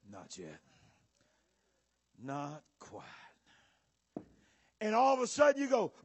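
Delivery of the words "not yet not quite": very low, soft murmur; hushed appraisal. Acted. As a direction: murmur it while inspecting closely. Acts the Lord inspecting his work.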